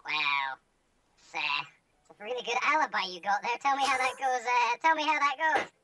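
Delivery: high, loud mocking sarcasm; sarcastic. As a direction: scoff at the alibi. Sarcastic player character.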